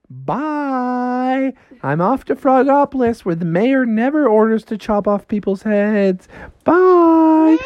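high, singsong taunting farewell; Froggy. Acted. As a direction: call a cheeky goodbye from up in the air. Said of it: "Froggy was like".

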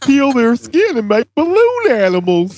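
high, gleeful unhinged villain; delighted maniac planning horrors; crazy voice. Acted. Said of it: "a crazy voice that just popped in my head".

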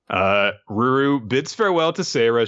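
usual speaking voice

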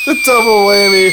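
announcer voice. Acted. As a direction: sell it like a TV announcer. Announces catchphrase after plain try.

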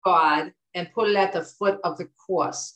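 imitating mom's Jersey accent, R dropped in offer. Daughter quotes mom's advice on suffering, Jersey accent. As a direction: say it like a Jersey mom, broad AW vowels. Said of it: "But she would say".

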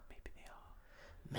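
slow, drawn-out whisper; mock conspiratorial whisper. Co-host musing on lizard rulers, slow mock whisper. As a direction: let it fade into a secretive hush.

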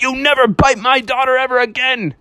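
high-pitched mock-stern warning; scolding threat. Father bear threatening the shark, high scolding voice.

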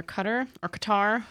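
Qatar said two ways, stress moved; two pronunciations. Shown as a demonstration. Reading out medal winners, unsure of Qatar, trying two pronunciations. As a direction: say Qatar one way, then the other. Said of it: "however you say it".

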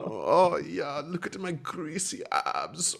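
slow, high hammed-up brag; mock macho pagan. Hammed-up brag to the co-hosts, slow and high. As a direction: show off greasy abs like a cartoon Norseman. Mock Thor persona joke.

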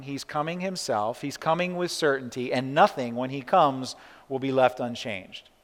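usual speaking voice

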